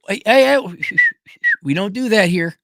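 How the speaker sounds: high, loud cut-off; firm interruption. Retold or imitated. Boyfriend stopping his girlfriend as she comes in upset, loud and firm. Acts out halting girlfriend's crying.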